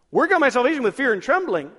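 urgent, anxious quote; mock alarm. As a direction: echo the verse as if it worries you. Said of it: "live every moment of my life scared".

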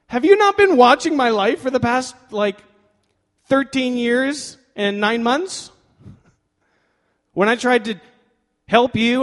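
loud, high-pitched protest; exasperated. Acted. Abram answers God after thirteen years, exasperated.

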